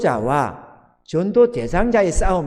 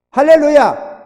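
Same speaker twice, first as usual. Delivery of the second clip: loud hallelujah; 9 semitones higher, 8 dB louder, slower than usual. Real, not acted. Congregational call to praise.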